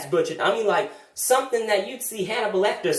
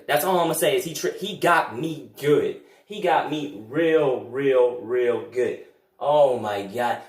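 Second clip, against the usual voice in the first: low, slow, drawn-out defeat; low slow dejected sigh; 7 semitones lower, slower than usual. Acted. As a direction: let it sink in slowly. Defeated admission.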